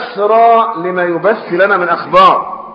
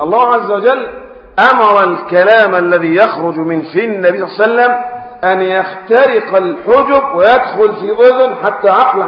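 normal talking voice

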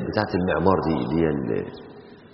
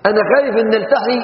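ordinary talk

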